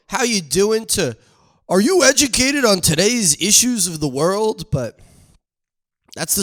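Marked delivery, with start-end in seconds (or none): 0.1-4.7 raised, mocking old-man voice. To the listener, mocking the sauna man's question. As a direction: ham up the stranger's sudden lofty question.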